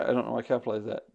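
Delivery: usual speaking voice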